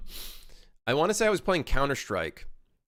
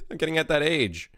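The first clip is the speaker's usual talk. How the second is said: ordinary talk, nothing marked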